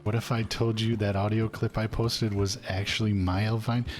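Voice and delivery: normal talking voice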